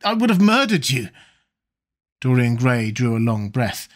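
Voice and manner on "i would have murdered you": high, stammering shock; appalled realisation with a stumble; horrified cry. A narrator's reading. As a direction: blurt it out aghast, pitched up. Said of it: "he cried".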